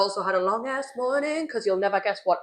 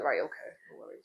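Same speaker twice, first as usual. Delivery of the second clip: resigned quiet; 10 semitones lower, 11 dB quieter than usual. Retold or imitated. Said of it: "I went".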